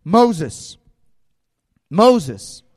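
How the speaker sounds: a measured call with a falling end. Acted. God calls to Moses from afar, calling out. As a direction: call the name out firmly, rising then falling.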